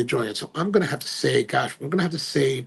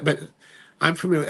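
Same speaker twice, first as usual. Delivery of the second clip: ordinary delivery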